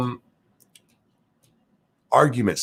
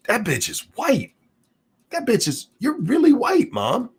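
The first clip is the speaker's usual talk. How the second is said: high, pitched-up mock outrage at Mom; mock exclamation to mom. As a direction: squeal it at Mom like it is obvious. Imagined line to her mom.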